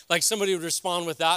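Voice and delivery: ordinary talk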